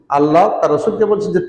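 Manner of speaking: ordinary delivery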